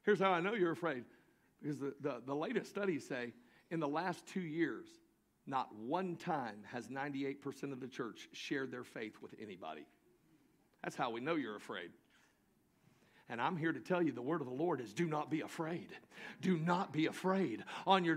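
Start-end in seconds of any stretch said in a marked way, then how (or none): 5.5-11.9 quiet and confiding. Acted.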